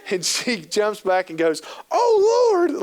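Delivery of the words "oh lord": very high, loud startled cry; startled mom crying out in the store; startled shriek. Retold or imitated. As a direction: shriek like a stranger just lunged at you. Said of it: "loud enough to now we're having a scene".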